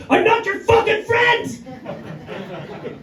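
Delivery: shouted. Retold or imitated. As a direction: lose patience and bellow it slowly. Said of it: "looked up at them and shouted".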